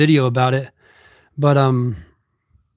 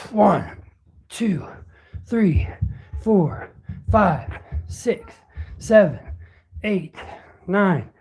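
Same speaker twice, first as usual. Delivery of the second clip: strained counting between reps, growing quieter; counting push-ups; 4 dB quieter, slower than usual. Real, not acted. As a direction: count the reps as if mid-set, getting tired.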